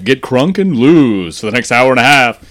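loud, slow announcer voice; radio announcer. Retold or imitated. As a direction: boom it like a station host.

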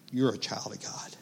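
hushed whisper, soft and tender. Acted. Pastor closes the passage for the congregation in a hushed whisper. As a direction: breathe it like a secret blessing.

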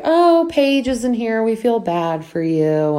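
high, cooing fake pity; mock sympathy. Group pretending to pity Paige, high cooing mock sympathy. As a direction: coo the sympathy, not meaning it.

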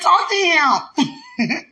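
very slow, high and loud command; playful slow booming order; drawn-out mock God voice. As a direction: drag out each word like a teasing God. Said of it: "That's how I feel".

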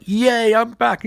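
high, hammed-up cheer; mock cheering. [name] tells the team he's back, mock cheering. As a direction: imitate a happy homecoming shout.

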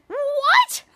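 very high rising "what"; shocked question. Retold or imitated. Mandy hearing she must wait, high shocked question. Acted caller's shocked reply.

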